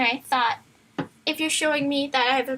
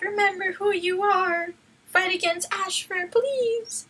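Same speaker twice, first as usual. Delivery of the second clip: pleading; 6 semitones higher, slower than usual. A narrator's reading. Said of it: "she yelled".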